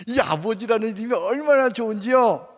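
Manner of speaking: ordinary talk, nothing marked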